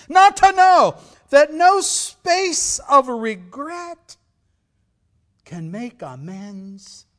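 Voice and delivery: ghost's lament. Acted. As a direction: wail it like a chained ghost. Declaimed ghost speech continues.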